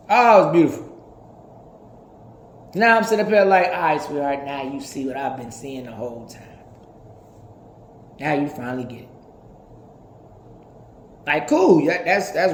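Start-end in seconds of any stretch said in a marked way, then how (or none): 3.7-9.1 tender. Acted. Speaking tenderly to a partner.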